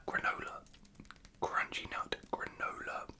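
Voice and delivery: ordinary delivery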